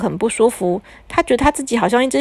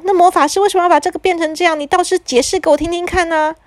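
scolding mother; 7 semitones higher than usual. A narrator's reading.

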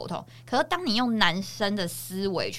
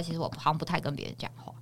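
whispering; 5 semitones lower, 10 dB quieter, faster than usual. Real, not acted. Confiding aside.

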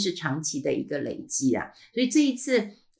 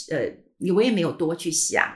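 usual speaking voice

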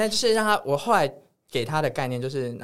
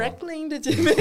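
"Brooklyn的姐妹" tossed off high and sassy, in English; sassy diva; 3 dB louder than usual. Shown as a demonstration. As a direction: camp girl-gang flair on "Brooklyn的姐妹". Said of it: "为什么". (用高亢娇嗔的语气说"Brooklyn的姐妹")

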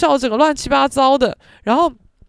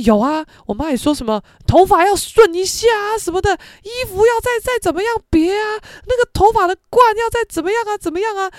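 higher, louder list of fussy demands; nagging mom; 10 semitones higher, 4 dB louder than usual. Retold or imitated. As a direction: rattle off the mom's nitpicking. Friend mimics mom's many opinions.

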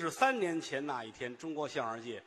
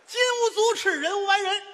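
much higher, sing-song proclamation; raised pitch; 10 dB louder than usual. Acted. Comedian proclaiming a proverb to his partner, in a much higher ringing voice. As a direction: lift the pitch way up like an opera line. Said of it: "你瞧，长调门儿了。".

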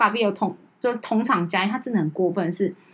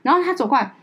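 normal talking voice

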